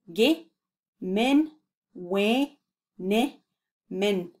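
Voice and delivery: Ge-men-we-ne-men drawn out very slowly; syllable by syllable. Shown as a demonstration. Teacher slowing the word right down for learners. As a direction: stretch every syllable of Ge-men-we-ne-men. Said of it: "We wene dann".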